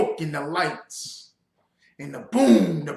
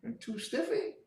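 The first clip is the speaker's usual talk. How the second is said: incredulous echo; 5 semitones higher, 13 dB quieter than usual. Real, not acted. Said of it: "mocking echo".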